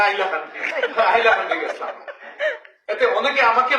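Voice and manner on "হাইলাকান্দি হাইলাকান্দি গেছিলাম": হাইলাকান্দি said as আইলাকান্দি, laughing; mock the Sylheti dropped h in হাইলাকান্দি; Sylheti accent. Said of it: "কেউ হাইলাকান্দি কইতে পারে না". (সিলেটি টানে আইলাকান্দি, হেসে হেসে)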